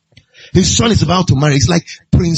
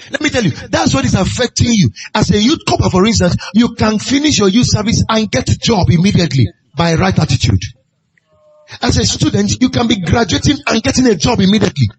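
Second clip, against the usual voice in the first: preaching; 6 semitones higher than usual. Acted. Preaching over music.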